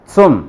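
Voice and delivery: सुम with the half t pushed from the throat; right ts sound. Shown as a demonstration. Teacher modelling the correct zum after the mistake. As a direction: put pressure on the start of सुम. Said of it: "throat पे".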